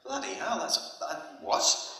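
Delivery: high, breathless gasp of disbelief, half under the breath; stunned disbelief. Retold or imitated. Hearing Eric trained as apprentice, stunned breathy disbelief. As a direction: gasp it like you can't believe it.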